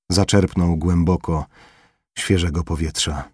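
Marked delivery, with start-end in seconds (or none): none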